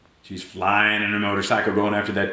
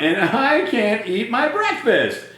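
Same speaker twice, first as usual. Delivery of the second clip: whiny complaint; 10 semitones higher than usual. Acted. Andrew laments his stuck tooth.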